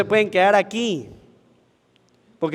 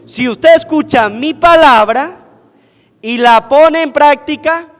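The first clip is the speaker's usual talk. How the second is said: emphatic preaching; 6 semitones higher, 7 dB louder than usual. Retold or imitated. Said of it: "Ahora dice Jesucristo".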